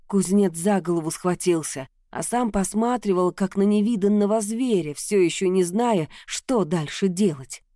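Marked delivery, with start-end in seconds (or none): none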